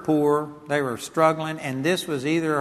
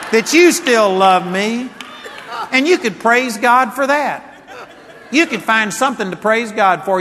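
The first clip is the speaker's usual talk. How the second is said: loud, raised exhortation; forceful preaching; 6 semitones higher, 8 dB louder than usual. Real, not acted. Preacher urging people facing divorce to praise God, loud and forceful. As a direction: urge the crowd to give praise.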